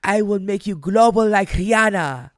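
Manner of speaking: put-on loud, high, grandiose French-accented proclamation. Sephora's CEO promising a global launch, big French accent. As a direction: boom out a promise as a French executive. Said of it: "part of my French accent".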